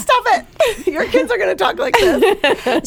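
high, laughing comeback; squealed mock indignation through laughter; mock protest. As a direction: playfully protest being teased, laughing. Acts out protest at husband's teasing.

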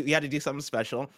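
usual speaking voice

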